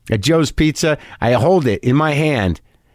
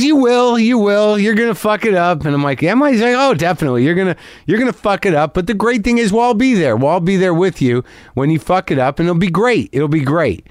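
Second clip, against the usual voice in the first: confident excited fan; 8 semitones higher than usual. Retold or imitated. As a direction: cheerfully promise he'll fail, beaming. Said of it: "he didn't change his tone".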